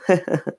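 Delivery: brief high chuckle; a quick pleased ha-ha. A narrator's reading. Sister owning up to her prank, chuckling. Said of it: "Lindsay chuckled".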